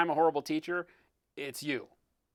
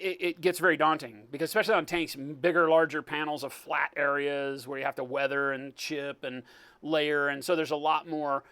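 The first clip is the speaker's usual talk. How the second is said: ordinary talk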